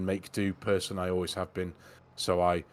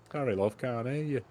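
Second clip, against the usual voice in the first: higher-pitched, airy mock apology; breezy fake apology to a partner; play-acted excuse; 5 semitones higher than usual. Pretending not to hear his wife, airy excuse.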